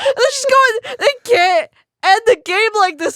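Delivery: high, loud, laugh-cracked voice. Real, not acted. Host retelling the crying friend, laughing. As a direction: fight laughter all the way through. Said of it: "trying so hard not to laugh".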